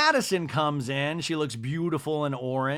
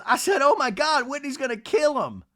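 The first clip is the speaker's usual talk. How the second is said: mock alarm; 11 semitones higher, 4 dB louder than usual. Acts out Craig's alarmed retort.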